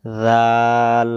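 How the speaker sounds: teacher names the letter জাল slowly; slow letter demo. Shown as a demonstration. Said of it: "পরের অক্ষরের নাম".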